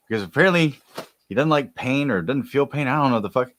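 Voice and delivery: normal talking voice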